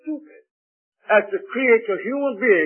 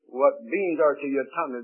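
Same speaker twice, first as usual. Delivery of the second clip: usual speaking voice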